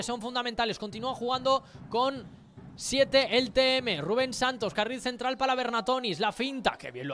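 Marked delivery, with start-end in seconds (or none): none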